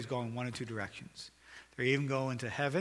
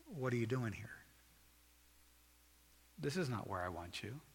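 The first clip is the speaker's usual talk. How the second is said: soft, gentle appeal; loving, broken-hearted; 6 dB quieter than usual. Acted. God looking at you with a broken heart, loving appeal. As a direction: ask it softly with a grieving love. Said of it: "But with a loving, broken heart".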